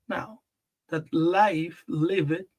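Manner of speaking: ordinary talk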